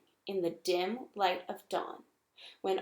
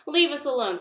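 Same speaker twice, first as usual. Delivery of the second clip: higher, louder frightened squeak; 6 semitones higher, 7 dB louder, faster than usual. A narrator's reading. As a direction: squeak it out, cowering. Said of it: "squeaked Dart as he crouched low".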